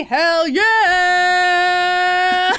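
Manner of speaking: high, loud "hell yeah" whoop; mock cheer. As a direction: cheer it like a party. Cheering the new witness.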